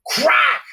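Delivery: high-pitched, sudden "crack" impact; imitated smack. Storyteller imitating an open-hand slap landing, explosive. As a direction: voice the blow as a sharp high cry.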